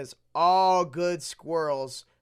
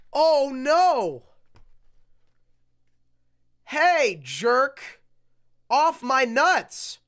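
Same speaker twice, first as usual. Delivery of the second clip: angry shout; 8 semitones higher, 9 dB louder, much slower than usual. Acted. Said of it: "he said".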